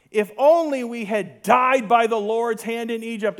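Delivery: whiny complainer. Retold or imitated. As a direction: read it like a grumbling crowd. Mocking Israelites' complaint read aloud.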